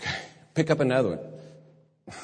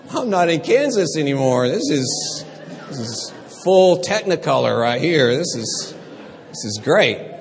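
louder, amazed and thrilled; excited wonder; 4 semitones higher, 7 dB louder than usual. Retold or imitated. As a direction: gush like a dazzled little girl. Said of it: "she was so funny".

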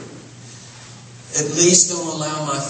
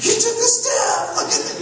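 put-on much higher, thrilled cheer to the toddler, breaking into a laugh; delighted parent. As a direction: praise the baby's first step with squealing joy. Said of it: "we're just like".

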